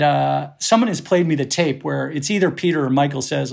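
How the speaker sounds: normal talking voice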